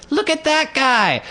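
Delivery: put-on very high, drawn-out child voice; toddler girl. Toddler ignoring mother to point, squeaky insistence. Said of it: "And she's like".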